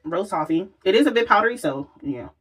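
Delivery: usual speaking voice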